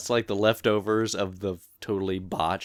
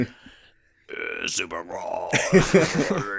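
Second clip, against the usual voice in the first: very low, slow, rocky announcer growl; deep gravelly announcer voice; 4 semitones lower, much slower than usual. Retold or imitated. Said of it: "got the most deep, gravelly voice".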